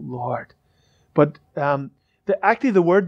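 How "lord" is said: hushed ashamed. Retold or imitated. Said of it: "we drop our heads and go".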